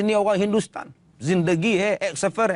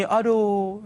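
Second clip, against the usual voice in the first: imitated pain groan. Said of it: "asal rukuk bunyi".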